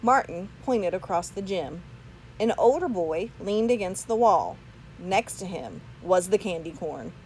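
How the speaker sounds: ordinary delivery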